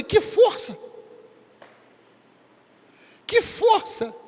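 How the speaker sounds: high, bewildered double question; puzzled Gideon. Acted. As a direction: ask it as if the answer makes no sense. Gideon questions God's answer.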